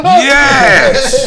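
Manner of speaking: high, loud celebratory yell; triumphant cheer. Real, not acted. Host getting the gift through the gap, real triumphant yell. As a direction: cheer a shot that just landed.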